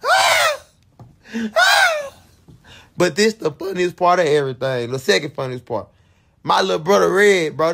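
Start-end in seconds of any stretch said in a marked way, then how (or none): 0.0-2.1 high, piercing scream of fright; shrieking in terror at the clown; terrified scream. Retold or imitated. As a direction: scream like the clown is right behind you. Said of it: "I was screaming like a motherfucker".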